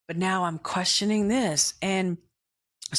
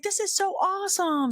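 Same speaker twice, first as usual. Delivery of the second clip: much higher, delighted squeal; gushing excitement; 12 semitones higher than usual. Retold or imitated. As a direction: gush with joy. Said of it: "I was like".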